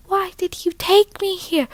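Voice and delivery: high-pitched put-on accent for Persephone. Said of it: "what accent Persephone would have had".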